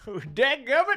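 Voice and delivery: put-on high, loud, slow old-timer; old-timer exclamation. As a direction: blurt it like a grizzled codger. Old-timer exclamation while laughing.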